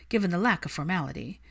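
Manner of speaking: plain reading voice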